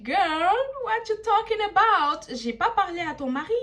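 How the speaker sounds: high, loud, drawn-out sassy English; incredulous girl-talk retort; sassy clapback. Retold or imitated. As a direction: snap back at her like a diva. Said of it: "I'm like".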